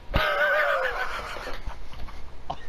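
long, squealing laughter; high laughing fit. Real, not acted. Friend cracking up over the backflip, high laughing fit.

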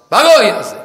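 high, sudden order to get out; indignant retold command; angry dismissal. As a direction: drive them away with a sharp rise.

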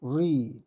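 "read" said as reed, long ee; "read" pronounced reed, the present form; present tense read. Shown as a demonstration. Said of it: "read라고 발음하죠".

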